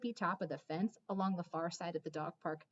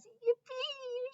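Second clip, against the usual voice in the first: much higher squeal of delight; high thrilled dog cry; joyful yelp. A narrator's reading. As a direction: yelp with pure joy. Dog overjoyed Hattie returns.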